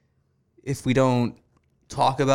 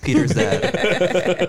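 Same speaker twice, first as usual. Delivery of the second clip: laughing; 7 semitones higher, 4 dB louder, much slower than usual. Real, not acted. Laughing repeat.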